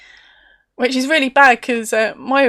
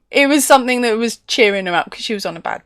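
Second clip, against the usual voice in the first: usual speaking voice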